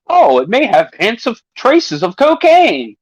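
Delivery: mock announcement. Host quoting the Prime warning, mock incredulous. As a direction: read it out slowly in disbelief.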